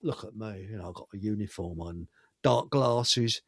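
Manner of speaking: low, soft mock swagger; lightly mocking a cocky policeman; mock teddy boy cop. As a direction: mutter it like a smug cop showing off his uniform. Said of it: "nineteen fifties teddy boys".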